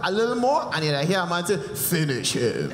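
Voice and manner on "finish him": low, slow game announcer voice; Mortal Kombat announcer. Retold or imitated. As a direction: growl it like the fight game voice. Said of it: "like Street Fighter or Mortal Kombat".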